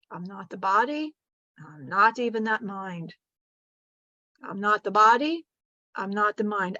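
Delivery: lightly held guided meditation; guided mantra. Acted. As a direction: chant the affirmation calmly. Leads a mantra exercise.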